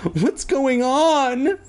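high, drawn-out alarmed cry; hammed-up alarm. A narrator's reading. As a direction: call it out high and slow. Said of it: "Andy cried".

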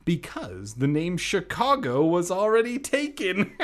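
high, loud joke capped with a laugh; joking. A narrator's reading. Aunt joking about the town's name, high and hammy. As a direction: deliver a corny punchline, then cackle. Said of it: "Aunt Marta joked".

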